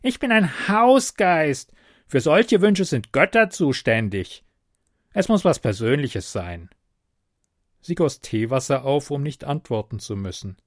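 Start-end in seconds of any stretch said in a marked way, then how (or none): 0.0-6.7 higher, louder goblin lecturing. A narrator's reading. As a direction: explain it haughtily like a house spirit. Goblin lectures about wishes.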